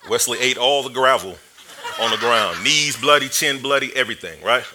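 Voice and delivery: normal talking voice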